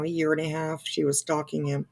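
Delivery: ordinary talk, nothing marked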